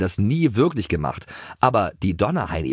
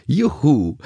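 high, louder whoop of delight; gleeful cheer; 7 semitones higher, 4 dB louder than usual. A narrator's reading. Narrator cheers for the pony riders' fun, gleeful. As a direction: let out a happy whoop.

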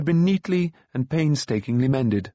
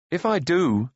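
mildly heated, higher-pitched answer; hot retort; 6 semitones higher than usual. A narrator's reading. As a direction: push back with a touch of temper. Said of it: "he answered hotly".

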